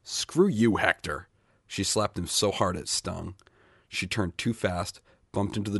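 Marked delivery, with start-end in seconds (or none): 0.0-1.3 furious shout at Hector; angry yell. Acted. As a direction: spit it at him in rage. Woman yells at Hector, slaps.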